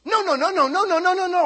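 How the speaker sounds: high, loud volley of no's; hammed-up panicky refusal; mock frantic refusal. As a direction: imitate someone refusing in a rapid-fire string. Acting woman's frantic refusal.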